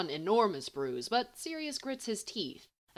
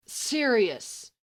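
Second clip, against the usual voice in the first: a louder warning call of his name; 4 semitones higher, 6 dB louder than usual. A narrator's reading. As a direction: warn him off with his name. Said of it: "Remus warns".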